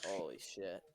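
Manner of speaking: low, soft stunned curse; stunned under breath. Real, not acted. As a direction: mutter it in shock. Tumbling car.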